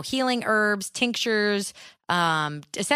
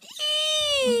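tiny high-pitched squeak, much higher; nervous squeak. Retold or imitated. Squeak of reaction to healer.

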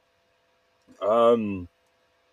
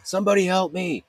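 retold screaming; 9 semitones higher than usual. Retelling their own night of screaming for help, mock scream. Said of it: "just screaming".

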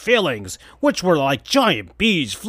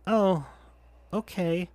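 higher, much softer let-down reply; sad and disappointed; 4 semitones higher, 8 dB quieter than usual. Acted. Tails hurt by Sonic's answer, soft and sad. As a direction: deflate quietly. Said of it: "sounding sad and disappointed".

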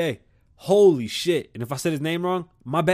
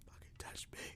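creeped-out whisper; 27 dB quieter than usual. Real, not acted. Vlogger whispering about a grasshopper touching him. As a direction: breathe it out in disgust.

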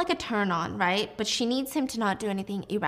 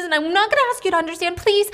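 loud begging; 8 semitones higher, 6 dB louder, faster than usual. Acted. Mina begs Diana.

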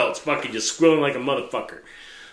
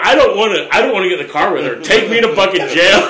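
louder pleading through laughter; laughing plea; 3 semitones higher, 5 dB louder than usual. Retold or imitated. Begging the cop for jail rather than the wife, laughing. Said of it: "I'm like".

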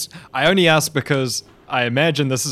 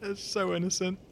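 high soft cooing; tender coo; 9 dB quieter than usual. Real, not acted. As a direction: coo softly over him. Player coos over character.

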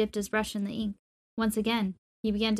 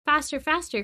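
higher, louder greedy urging; impatient cry; 5 semitones higher, 4 dB louder than usual. A narrator's reading. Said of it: "cried the emperor, impatient with greed".

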